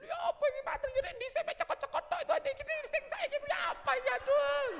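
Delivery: very high, fast, laughing panic; panicked patient. Pretend. Patient panicking to the doctor, very high and hammed-up. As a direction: fall apart in mock panic.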